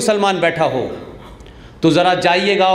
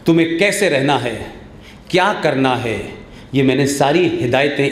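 ordinary talk, nothing marked

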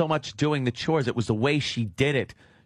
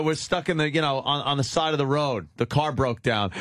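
ordinary talk

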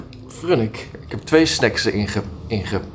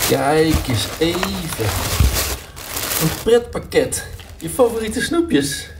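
excited pet talk; 5 semitones higher than usual. Real, not acted.

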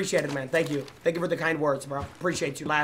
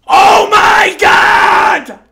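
very loud, very slow outburst; shout; 18 dB louder, much slower than usual. Real, not acted. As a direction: stretch out a stunned yell. Stunned by enemy play.

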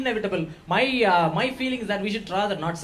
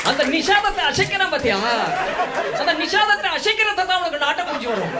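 excited Tambram mama; 10 semitones higher, 4 dB louder, much faster than usual. Retold or imitated. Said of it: "excited mama explains raga".

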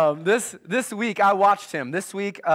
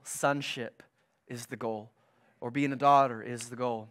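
ordinary delivery

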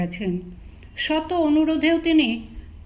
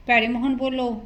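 ordinary delivery, nothing marked

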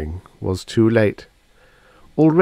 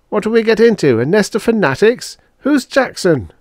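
very high, loud indignant questions; exasperated protest; 14 semitones higher, 6 dB louder than usual. A narrator's reading. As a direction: demand answers in exasperation. Furious captive protests to his companion.

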